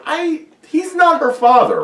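high, slow confused question; mock confusion. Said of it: "I was like".

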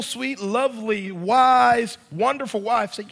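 ordinary talk

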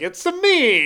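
put-on high, loud Italian Mario accent; a hammed-up Italian plumber shout. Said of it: "he was like".

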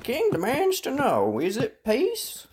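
high, slow herald's call. Acted. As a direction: call out the question slowly. Second messenger repeats the question.

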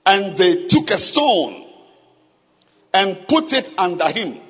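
slow, measured Bible reading; scripture reading. Acted. Preacher reading a Bible passage to the congregation, slow and solemn. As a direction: read it phrase by phrase with long pauses.

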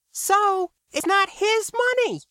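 very high-pitched character voice; KB interjecting. A narrator's reading. KB puts in about the mayor's money, high-pitched objection. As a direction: cut in with a high, insistent voice. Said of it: "K B put in".

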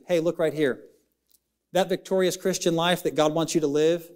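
usual speaking voice

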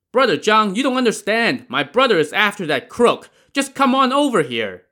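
high loud pleading call; urgent call. A narrator's reading. Gang leader urging the boatman back, loud call. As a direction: yell it, urging him back.

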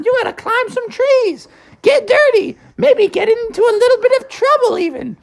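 high motherly scolding; mom voice. Acted. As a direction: nag him out of the house like a mother. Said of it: "his mom came into the room and said".

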